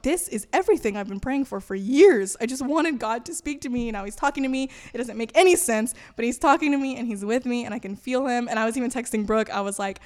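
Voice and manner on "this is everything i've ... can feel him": excited, louder, punching key words; retold excitement. Telling of God finally talking to her, excited. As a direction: gush it out with a lift. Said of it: "I was so excited".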